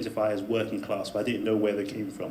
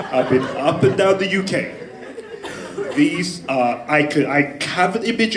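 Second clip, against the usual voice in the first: louder and higher, laughing through fond exasperation; laughing excited rant; 6 semitones higher, 6 dB louder than usual. Real, not acted. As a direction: build it up, half laughing, mock exasperated. Fond rant about Scouse lads.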